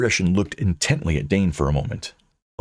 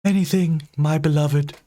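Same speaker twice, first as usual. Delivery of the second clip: high, airy ghost lover; doting ghost; 8 semitones higher than usual. Acted. Ghost coos to her fiancé. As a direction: coo like a lovesick spirit.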